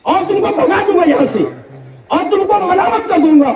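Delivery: high, shouted furious threat; threatening father. Acted. Father threatening Ibrahim with stoning and exile, shouted threat. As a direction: rage at the son who dares advise you.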